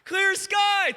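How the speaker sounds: high, drawn call from afar; called-out report. Retold or imitated. Servant reporting again to Elijah, calling out.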